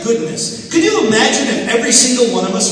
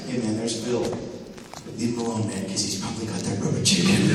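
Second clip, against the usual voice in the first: put-on lower, softer, uneasy teen warning his friends; wary bully; 11 semitones lower, 8 dB quieter than usual. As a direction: warn your pals quietly to back off. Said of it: "he would say this to his friends".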